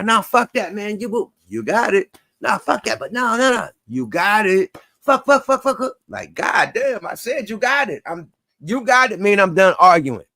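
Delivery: high, loud, exasperated ranting, hammed up; mock argumentative girlfriend. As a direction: play a girlfriend who won't drop the argument. Acting out a nagging argument.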